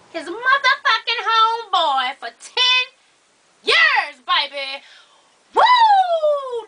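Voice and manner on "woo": excited whoop. Real, not acted. Shocked at a viewer's cheating story.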